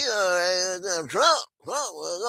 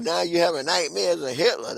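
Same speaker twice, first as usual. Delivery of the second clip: usual speaking voice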